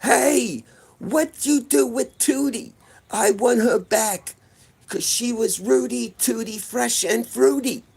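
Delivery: high, slow put-on caller voice; hammed-up kid demanding Tootie back; kid prank caller. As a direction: kids prank calling the shopping channel operator, high and silly. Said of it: "we'd always be like".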